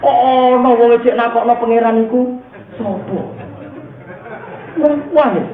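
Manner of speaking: very high, loud imitation of a tearful outcry; mock wailing protest. Rabi'ah protesting that anyone asks who God is, mock wailing. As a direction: wail it out high and loud, hammed-up. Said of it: "nangis terseduh-seduh".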